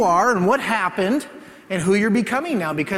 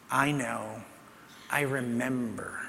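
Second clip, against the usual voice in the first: low, much softer, comforting words; tender reply; 5 semitones lower, 10 dB quieter, slower than usual. Retold or imitated. Jesus reassuring the weeping teen in the vision, tender and soft. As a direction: answer gently, like a friend who has been there.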